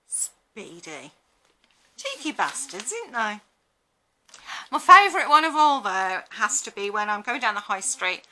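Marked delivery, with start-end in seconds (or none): none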